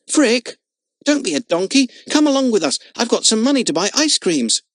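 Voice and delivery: high, loud call to someone walking off; calling after. Acted. Jimmy calls after departing Frick, loud high call. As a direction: shout after him to come back. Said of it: "Jimmy called after him".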